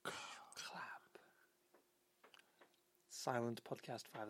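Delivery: unvoiced whispered word. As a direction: breathe it out silently. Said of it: "That's a quiet".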